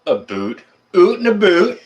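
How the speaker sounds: exaggerated Canadian "oot and a boot"; mock Canadian accent. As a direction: ham up Canadian vowels, rising high and loud. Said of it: "I don't have an accent".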